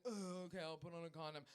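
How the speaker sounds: low, soft mock reluctance; subdued imitation of reluctant agreement; grudging giving in. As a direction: give in quietly, imitating a reluctant man. Imitates men giving in after fight.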